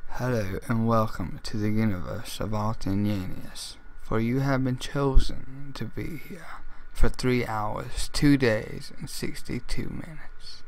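loud, grand trailer-announcer voice; big booming announcer welcoming chosen ones; dramatic announcer read. Acted. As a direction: boom it out like an epic game intro. Dramatic intro line recorded for demo.

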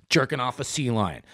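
ordinary delivery

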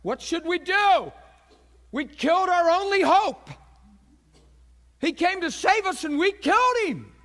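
loud, high cries of guilt; anguished crowd. Acted. Convicted crowd pleading to Peter, anguished cries. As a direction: cry out in acted desperation, cut to the heart. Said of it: "We're cut to the heart".

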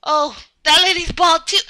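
usual speaking voice

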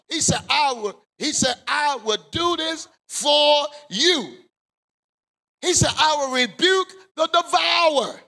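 rising, shouted preacher's cadence; preaching at full force. Acted. Preacher roaring Malachi to the congregation. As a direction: build the scripture to a roar.

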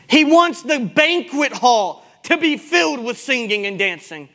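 impassioned sermon delivery; full-force preaching. Real, not acted. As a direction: proclaim it slowly to a full church.

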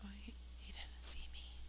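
hushed frightened whisper. Real, not acted. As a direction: breathe it, hiding. Hiding from mob, whispering.

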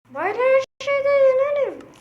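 squeaky little character boasting about winning; high cartoon kid voice. Character bragging to his rival, squeaky cartoon voice. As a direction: pitch way up into a toy voice, cocky.

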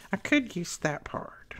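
soft, slow whisper to herself; whispered musing. Real, not acted. Crafter musing to herself over paper, whispered. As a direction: think it over under your breath.

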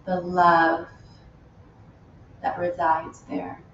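unhurried meditation guide with long pauses; spacious, measured yoga-teacher delivery; guided meditation. Real, not acted. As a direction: lead them gently inward, phrase by phrase. Leads a loving kindness meditation.

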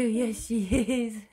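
laughing. Real, not acted.

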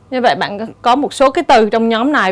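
usual speaking voice

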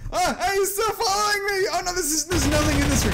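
high-pitched frantic cry; panicked. Real, not acted. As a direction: wail while being chased. Chased in empty room.

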